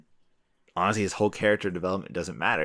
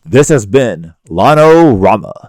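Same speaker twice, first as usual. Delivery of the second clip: announcer voice; 2 semitones higher, 13 dB louder than usual. Shown as a demonstration. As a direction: sign off like a big show announcer. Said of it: "my best, uh, announcer voice".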